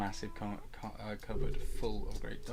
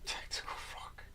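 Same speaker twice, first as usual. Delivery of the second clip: breathy whisper echo; 6 dB quieter than usual. Acted. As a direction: whisper it back. Echoing the phrase back.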